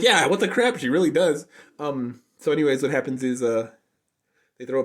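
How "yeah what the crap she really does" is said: higher, louder teasing squeal; mock outrage. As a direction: squeal in playful agreement. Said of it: "And it's like".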